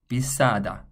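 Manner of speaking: 비싸다 with a tense doubled ss; Korean word demo. Shown as a demonstration. Teacher models the new word for students, clear demo. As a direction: model 비싸다 crisply for the learner. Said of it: "The next word I would like you to try is".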